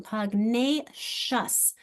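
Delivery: pugnacious said very slowly with a long nay; teacher modelling pugnacious; slow model word. Shown as a demonstration. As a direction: draw out pugnacious so the long vowel shows. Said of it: "notice how it's long".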